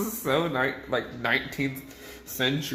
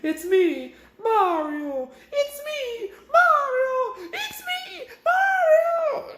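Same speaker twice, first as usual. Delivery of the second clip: each Mario call higher and louder, ending in a screamed cry; building Mario yell; 4 dB louder, much slower than usual. Retold or imitated. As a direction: crank the Mario line up until it breaks into a shriek. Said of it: "he gets higher and fucking louder with each one".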